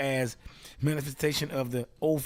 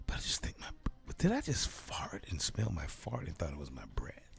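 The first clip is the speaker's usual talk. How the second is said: whisper; 8 semitones lower, 7 dB quieter than usual. Acted. Worried patient confides.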